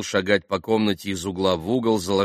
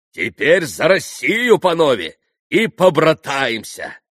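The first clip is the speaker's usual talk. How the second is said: loud, high, drawn-out call; rally everyone with a slow, loud toast; rousing toast; 8 semitones higher, 9 dB louder, slower than usual. A narrator's reading. Mitya proposing brotherhood to the gentlemen, loudly rousing.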